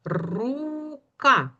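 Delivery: РУКА stretched on a long high У, then a short КА; drawn-out syllables. Shown as a demonstration. Teacher leading children in saying the word together, drawn out. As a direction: say Рука slowly by syllables, holding the У. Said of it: "Це слово вимовимо разом".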